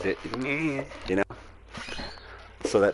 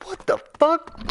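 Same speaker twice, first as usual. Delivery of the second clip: shocked outburst; 6 dB louder than usual. Retold or imitated. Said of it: "Jack was like".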